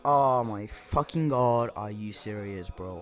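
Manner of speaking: low, sinking, slow groaned words; guilt-stricken dismay. Retold or imitated. Student as the guilt weighs on him, low dismay. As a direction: let pitch and volume sink in weary horror.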